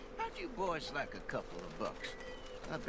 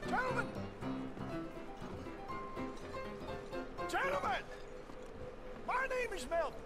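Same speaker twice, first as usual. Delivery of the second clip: high showman's call to the room; 8 semitones higher, 6 dB quieter than usual. Acted. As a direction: hail the whole bar at once. Showman calls a bar crowd.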